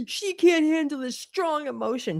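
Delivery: a high, put-on mocking voice; mocking whine. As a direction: parrot John's complaint in a silly high voice. Parodying John's complaint.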